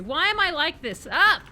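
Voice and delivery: rising into a loud yelp; flustered yelling. Real, not acted. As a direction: cry out in exasperated panic. Flustered in a tough fight.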